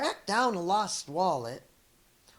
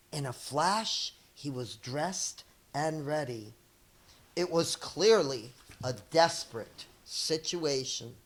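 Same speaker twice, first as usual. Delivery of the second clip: plain reading voice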